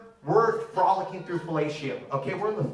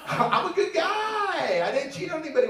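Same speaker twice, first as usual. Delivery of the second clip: mock pleading; 10 semitones higher than usual. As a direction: plead your innocence in a high stretched voice. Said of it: "Why do you say it like that?".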